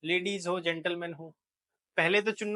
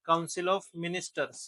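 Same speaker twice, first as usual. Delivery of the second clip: slow dictation; much slower than usual. Shown as a demonstration. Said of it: "teacher dictating".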